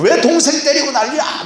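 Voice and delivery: very high, hammed-up scolding; scolding parent. Parent telling off the older brother for hitting, high mock scolding. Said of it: "그래도 한참 혼내고 나면".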